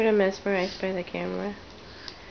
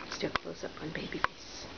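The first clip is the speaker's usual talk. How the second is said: breathy hushed whisper; 3 semitones lower, 12 dB quieter than usual. Real, not acted. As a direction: whisper it softly. Whispered aside.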